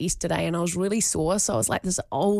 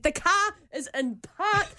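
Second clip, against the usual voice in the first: exasperated retort; 11 semitones higher than usual. Retold or imitated. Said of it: "getting so irate and annoyed".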